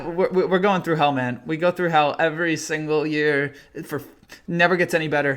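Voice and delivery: usual speaking voice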